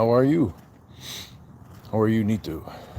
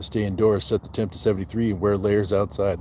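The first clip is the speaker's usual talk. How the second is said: normal talking voice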